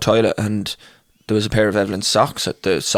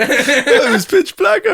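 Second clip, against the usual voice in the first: high, slow words squeezed out through laughter; helpless laughter at a friend's blunder; laughing hard; 4 dB louder, much slower than usual. Real, not acted. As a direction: barely get it out for laughing. Cracking up at the story.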